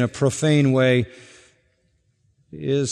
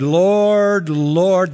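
loud, higher "Lord, Lord" with falling tune; mock zealous; 4 semitones higher, 5 dB louder than usual. Sayers calling out to the Lord, mock zeal. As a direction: say "Lord, Lord" with showy zeal, louder. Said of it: "They say it twice for effect".

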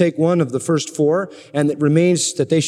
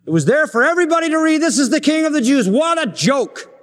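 scornful mockery; 10 semitones higher than usual. Preacher mocks Pilate's sign.

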